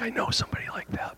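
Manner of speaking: quick breathy whisper, half joking; whispered aside. Retold or imitated. Pastor confides a joke to the congregation, whispered. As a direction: lean in and whisper it like a secret.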